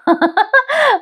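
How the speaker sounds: high-pitched burst of laughter; amused laugh. Real, not acted. Narrator laughing at her own aside about the sugar, amused laugh.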